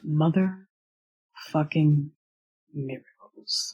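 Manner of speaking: slow, fading into a whisper. Acted. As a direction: let the last word go to a whisper. Equius agrees, touched.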